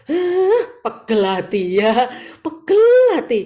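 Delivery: loud high fed-up cry; exasperated outburst. Acted. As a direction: blow off steam loudly. Venting frustration. (luapan kesal keras dan tinggi)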